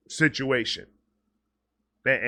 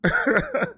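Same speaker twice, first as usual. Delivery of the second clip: a much higher, squealing burst of laughter; real delighted laugh; laughing at your own outburst; giddy laugh. Real, not acted. Laughs after peacock shout.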